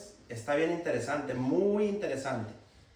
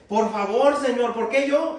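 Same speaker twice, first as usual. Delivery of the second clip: pleading cry; 4 semitones higher, 7 dB louder, slower than usual. Acted. Moses pleading with God.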